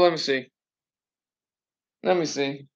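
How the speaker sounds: ordinary talk, nothing marked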